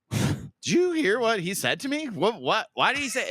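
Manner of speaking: high, slow, incredulous question; outraged, asking the table; indignant retelling. Retold or imitated. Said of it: "I said to the other guys".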